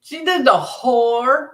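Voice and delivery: drawn-out Southern drawl; Southern accent. Shown as a demonstration. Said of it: "in a Southern accent".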